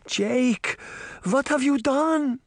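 high, horrified grandmother's voice; despairing cry. A narrator's reading. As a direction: cry out in dismay at a son. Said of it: "Granny Relda was full of despair".

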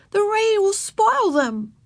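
put-on high, slow, drawn-out child's complaint; protesting child. A narrator's reading. Girl fearing rain will ruin boots, protesting. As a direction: plead in a small high voice. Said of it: "protested Ramona".

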